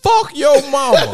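high, loud mock shout through laughter; mock cashier insult. Son mimics the cashier insulting his mom, loud and high. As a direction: yell it like the rude cashier.

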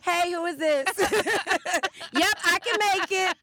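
high, loud, chirpy yes-to-everything voice; hammed-up eager phone answer; imitating eager acceptance. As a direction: rattle off yeses like a people pleaser. Imitating Joyanne answering invitations.

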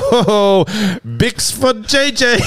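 high, slow, sing-song cereal ad voice; goofy ad voice. Pretend. Pitching a joke TikTok to friends, in a high sing-song ad voice. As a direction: pitch it like a cheesy breakfast commercial.

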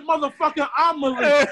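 loud indignant outburst; retold angry shout. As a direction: bark it back at him, outraged. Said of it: "I couldn't take this one".

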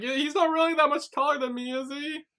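high, fast, tearful whiny voice; tipsy crying guy. Retold or imitated. Retelling the drunk crying character, high whiny voice. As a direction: sound drunk and sniffly.

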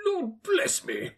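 high, drawn-out gasp of amazement; breathless astonishment. A narrator's reading. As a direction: cry out as if winded by shock. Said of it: "as if his breath were taken away".

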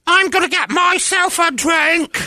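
very high, slow mock Irish accent. Wife across the couch, mock Irish accent. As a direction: ham up an Irish accent badly. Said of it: "talk in the Irish accent".

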